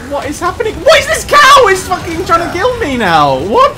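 shrill, loud hysterical shout. Real, not acted. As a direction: shriek in disbelief. Cow attacking in game.

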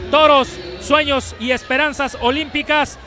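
usual speaking voice

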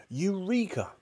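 high, triumphant exclamation; excited cry. A narrator's reading. Mister Cranky finding the missing ingredient, excited high cry. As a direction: cry out at a discovery. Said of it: "cried Mister Cranky".

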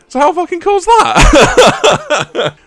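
high, loud and drawn out; real excitement, slow and loud; thrilled. Real, not acted. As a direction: stretch it out in delight. Thrilled at the coincidence.

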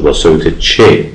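ordinary talk, nothing marked

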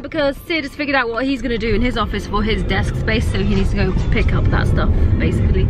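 usual speaking voice

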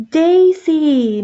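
joyful shout across the crowd. Acted. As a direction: call out to the lost hen. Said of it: "Maymay shouted".

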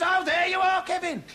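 high-pitched, loud programme boss; exasperated boss. Acted.

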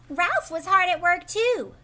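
ordinary delivery

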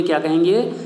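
normal talking voice

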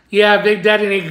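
ordinary delivery

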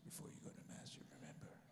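unvoiced hushed build-up; whisper. Guard whispering before the big reveal. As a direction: whisper it like a sacred secret.

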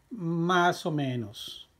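drawn out for learners; slow model reading. Shown as a demonstration. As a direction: stretch each word for the class. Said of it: "teacher models the answer".

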